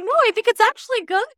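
high, loud, emphatic insistence; emphatic mock reply. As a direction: insist brightly that it's good. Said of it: "I'm like".

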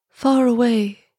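lightly intoned, musical statue voice; a hint of singing tone on a gentle call; low musical voice. A narrator's reading. Statue beginning a tale to the swallow, lightly musical. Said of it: "continued the statue in a low, musical voice".

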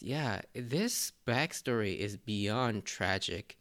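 usual speaking voice

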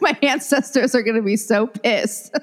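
talking through laughter; laughing. Real, not acted. Cook late for the ancestors' dinner, laughing. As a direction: laugh at your own predicament as you say it. Jokes while laughing at herself.

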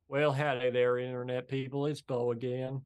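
imitated Southern greeting; Bo of the Fifth Column. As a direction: put on an American Southern accent. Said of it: "he starts all his videos".

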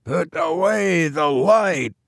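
high, slow, drawn-out call; distant voice. A narrator's reading. Stranger replies from the boat, distant calling voice. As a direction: call back from far across water. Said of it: "This time there was a reply".

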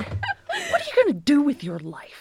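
high, held-back mock scolding. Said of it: "it turned into a Twisted Sister video".

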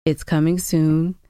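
normal talking voice

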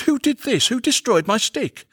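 high, aggrieved lament; dazed dismay. A narrator's reading. Going finds his stick destroyed, high and dismayed. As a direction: cry out over a ruined treasure. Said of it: "with a dazed expression and said".

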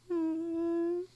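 high, sniffly pretend sob; mock crying. Parent shows how Brady started crying, mock sobbing. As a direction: act out a little cry with sniffs. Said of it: "started to cry".

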